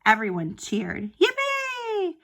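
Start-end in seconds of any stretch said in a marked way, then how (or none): 1.2-2.2 high squeal of joy; cheering yippee. Acted. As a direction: cheer it like a delighted crowd. Crowd cheering in story.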